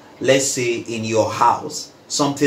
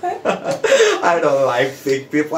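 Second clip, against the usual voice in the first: slow, laughing through the words; laughing repeat; 2 semitones higher, slower than usual. Real, not acted. Retelling her judgment with a laugh. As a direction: laugh as you say it again.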